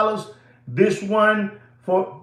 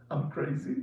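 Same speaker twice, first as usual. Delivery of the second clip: soft giggle through the words; laughing; 4 semitones lower, 15 dB quieter than usual. Real, not acted. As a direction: laugh at yourself while saying it. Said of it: "I'm just laughing".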